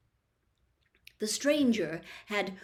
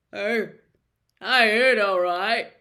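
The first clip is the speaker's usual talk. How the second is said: Hagrid's voice cracks, much louder, odd timbre; voice breaking; 10 dB louder than usual. Acted. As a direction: choke on the words, half-giant grief. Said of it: "a slight break in his voice".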